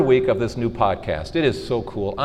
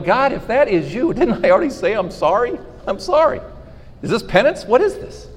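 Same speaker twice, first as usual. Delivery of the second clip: high, laughing, incredulous plea; half-laughing, pleading with God; exasperated plea; 9 semitones higher than usual. Retold or imitated. Man to God over back pain, laughing plea.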